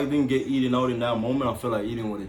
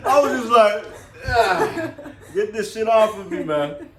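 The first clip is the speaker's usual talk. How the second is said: laughing retelling; 9 semitones higher, 5 dB louder than usual. Real, not acted.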